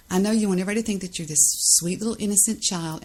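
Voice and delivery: usual speaking voice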